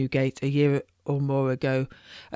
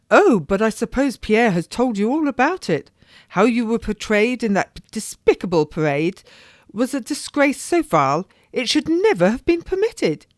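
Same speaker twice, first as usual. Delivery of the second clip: higher, outraged noblewoman; a society lady scandalised; indignant lady; 7 semitones higher, 5 dB louder than usual. Acted. Lady condemning the parade to her friend, indignant.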